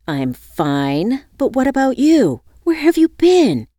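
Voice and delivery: rising, loud accusing question; accusatory. Acted. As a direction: demand an answer, accusingly. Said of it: "she asked accusingly".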